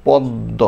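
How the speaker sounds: পদ্ম said "poddo", doubled দ. Shown as a demonstration. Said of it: "দয় দ, মনে হয় না এরকম". (পদ্ম দ্বিত্ব দ-এ)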